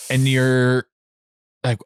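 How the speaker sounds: usual speaking voice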